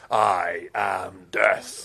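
slow, dark cry of doom; deep movie voice. Pretend. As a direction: boom it out like a villain. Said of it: "act jy nou hierdie snaakse voice".

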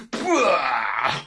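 drawn-out yuck noise; a long, revolted "bleurgh"; disgusted noise. Acted. Dog narrator recoils at smelly cat food, disgusted noise.

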